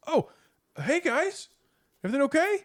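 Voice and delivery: squeaky friendly host voice checking on guests; high put-on greeting. GM voices Jack greeting players.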